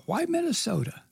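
high, disbelieving why Minnesota; mock bafflement; incredulous. Real, not acted. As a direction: pitch it way up in disbelief. Incredulous rhetorical question.

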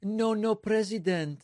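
high-pitched mock Mexican accent; put-on Mexican accent, pitched well up; mimicked Mexican accent. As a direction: parrot a hammed-up Mexican-accented English. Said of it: "doing his best impression of a Mexican".

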